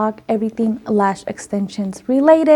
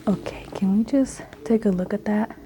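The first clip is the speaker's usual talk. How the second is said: hushed awe; 10 dB quieter than usual. Real, not acted. Hushed admiration of the fan.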